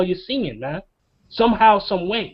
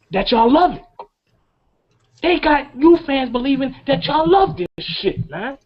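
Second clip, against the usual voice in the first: rising, emotional plea; too emotional; 7 semitones higher than usual. Real, not acted. Pleading with young fans, voice climbing with emotion. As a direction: voice climbing high with feeling. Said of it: "I got too emotional right there".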